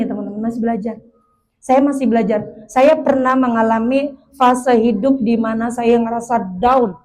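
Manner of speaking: ordinary delivery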